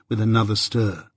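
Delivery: usual speaking voice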